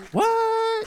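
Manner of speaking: high, squeaky burst of disbelief; stunned what. Retold or imitated. Reading his own name in the email, a stunned high what. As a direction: jump way up on it, unable to believe it.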